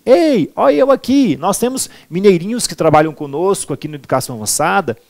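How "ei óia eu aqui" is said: higher-pitched mock Minas Gerais call; sing-song Minas Gerais accent, pitched up; mineirinho accent. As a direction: imitate a friendly Mineiro calling out. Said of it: "os mineirinhos falam assim".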